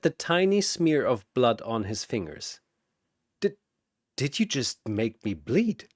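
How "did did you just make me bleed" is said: quiet, stunned question, voice dropping; stunned disbelief. Acted. Shazam staring at blood on his fingers, stunned.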